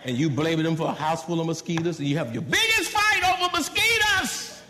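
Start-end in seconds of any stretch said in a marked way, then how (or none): 2.4-4.6 very high, loud comic outburst; incredulous yell. Acted. Mocking a couple's fight over mosquitoes, incredulous yell. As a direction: blow it up into an absurd shout.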